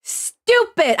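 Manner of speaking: high-pitched, exasperated outburst; mad outburst. Real, not acted. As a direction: burst out with it, high and angry. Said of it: "I'm so mad!".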